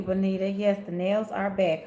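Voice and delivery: ordinary talk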